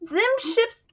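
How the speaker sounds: put-on a high, tearful old woman; lightly voiced distraught widow; grieving widow. Old widow voices her grief.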